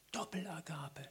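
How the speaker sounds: breathy whispered aside, no voice. Real, not acted. Preacher whispering a pointed aside to the congregation. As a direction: drop to a secret whisper. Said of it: "whispered aside".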